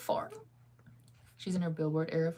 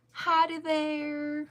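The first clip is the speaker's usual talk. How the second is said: slow, high mock Southern drawl; hammed-up Southern cowboy accent; 9 semitones higher, 3 dB louder, much slower than usual. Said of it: "I don't have a good Southern accent".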